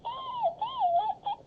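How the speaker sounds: extremely high, squeaky yelps; re-enacted squeal. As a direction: squeal it like a mock panic on a ride. Re-enacts peeing as ride ends.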